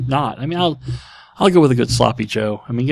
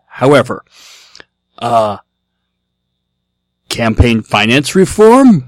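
Neanderthal robot voice; 8 dB louder, slower than usual. Pretend. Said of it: "in your best Neanderthal robot voice".

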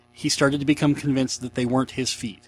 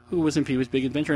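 normal talking voice

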